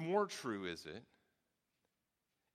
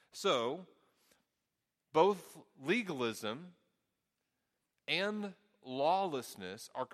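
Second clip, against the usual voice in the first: slow, deliberate words; sermon emphasis, slowed right down; 3 semitones higher, 3 dB louder, much slower than usual. Acted. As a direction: spell out each word to drive it home. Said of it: "preacher slows for emphasis".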